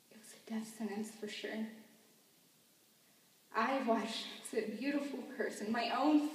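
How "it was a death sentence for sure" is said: hushed grieving whisper. Acted. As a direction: breathe it out in a whisper. Grief over son's faith.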